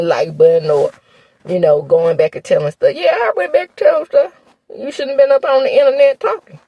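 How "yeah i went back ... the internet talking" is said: high-pitched mocking comeback; sassy retort. Vlogger answers viewers who object to her telling, sassy retort. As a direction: throw it back at the complainers, high and sassy.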